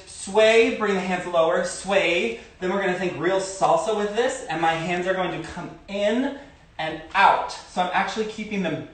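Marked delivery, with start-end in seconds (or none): none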